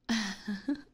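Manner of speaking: breathy teasing chuckle. Acted. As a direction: chuckle at the prisoner's plight. Vampire amused by her captive.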